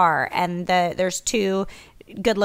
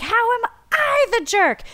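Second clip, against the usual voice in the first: high-pitched mock outrage; indignant protest; 14 semitones higher than usual. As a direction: re-enact being wrongly accused, pitched way up. Retold protest to Chris.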